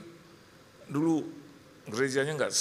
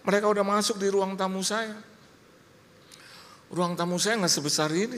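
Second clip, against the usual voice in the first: usual speaking voice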